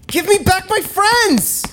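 desperate high yell at the orb. Acted. Player yelling at the orb for friends. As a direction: cry out for the lost friends. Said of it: "and I yell".

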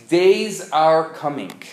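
slow, loud solemn proclamation; scripture reading. Acted. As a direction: read it out like a prophet's words. Reading the prophet's verse.

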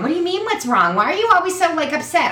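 high, breezy mock mother; imitating mom. Daughter voicing mom scolding her, high breezy voice. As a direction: brush the worry off as mom. Mom's dismissive reply voiced.

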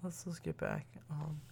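sleepy, drowsy talking; nodding off. Real, not acted. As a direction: talk like you're falling asleep. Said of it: "I'm falling asleep".

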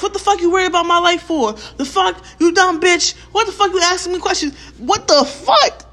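high-pitched, hammed-up irate rant; crazy person ranting. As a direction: go off like someone unhinged. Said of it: "only a crazy person sounds like that".

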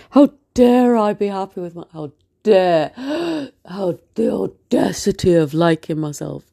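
loud theatrical indignation with a gasp; hammed-up, scandalised outburst; mock outrage. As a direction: pretend to be scandalised at yourself. Mock outrage at her self-satisfaction.

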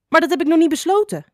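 high, loud, rushed protest; astonished cry. A narrator's reading. Klein Addertje objects to being called a friend, astonished. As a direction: blurt it out fast in astonishment. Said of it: "riep Klein Addertje verbaasd".

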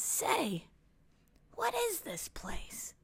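hushed, high, slow little voice; wondering little alien. A narrator's reading. Little friend on a strange planet, hushed and wondering. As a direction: breathe the question like a wide-eyed little stranger.

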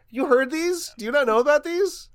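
ordinary talk, nothing marked

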